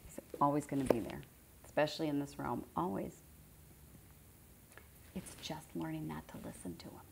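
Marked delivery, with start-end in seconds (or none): 5.1-7.0 soft, near-whispered remark; quiet, almost voiceless aside; hushed aside. Real, not acted. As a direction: drop to a hush as if confiding. Hushed aside about inner voice.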